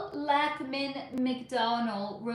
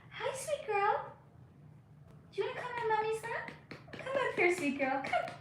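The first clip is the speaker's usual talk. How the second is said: much higher, softer pet-talk coo; 11 semitones higher, 4 dB quieter than usual. Real, not acted. Owner coaxing her cat onto her lap, much higher soft baby talk. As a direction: coax the kitty up onto your lap sweetly.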